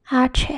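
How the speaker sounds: "H" said as Spanish hache, AH-cheh; name "H" in Spanish as AH-cheh. Shown as a demonstration. Teacher naming the letter for learners, Spanish AH-cheh. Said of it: "The letter is pronounced as".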